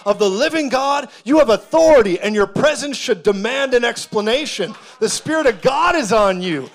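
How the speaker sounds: rhythmic preaching building to a high, loud peak; preaching at full force. Real, not acted. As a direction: drive each repetition harder, rousing the congregation.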